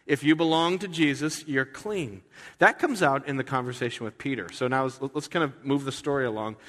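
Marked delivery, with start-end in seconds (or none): none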